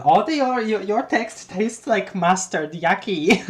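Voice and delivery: high, loud, slow disgusted Terezi; exaggerated yuck, high and loud. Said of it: "she experiences colors as in".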